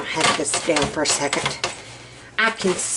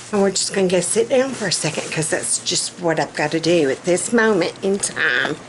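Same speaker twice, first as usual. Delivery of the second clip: ordinary talk, nothing marked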